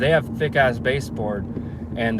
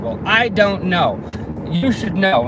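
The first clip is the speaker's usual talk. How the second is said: higher, louder, stretched-out mock exasperation; irritated retort; 6 semitones higher, 4 dB louder, slower than usual. Customer refusing to give the worker directions, mock irritated retort. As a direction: imitate an indignant customer pushing back. Retold quarrel, customer snapping back.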